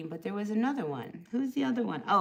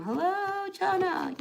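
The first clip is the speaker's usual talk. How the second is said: high lilting greeting; sing-song hello; 12 semitones higher, slower than usual. Real, not acted. Tarot reader greets viewer Chana in a high sing-song.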